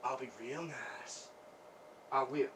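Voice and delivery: low, hushed placating promise. Acted. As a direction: back off and promise softly, pitch dropped. Backs off, promises to behave.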